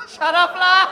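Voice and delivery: loud, laughing "shut up"; laughing shout. Real, not acted. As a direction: yell it while cracking up. Friend's fake apology.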